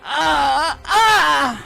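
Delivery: high, rising cries of labour; straining cries of pain. Acted. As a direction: push and cry out. Giving birth cries.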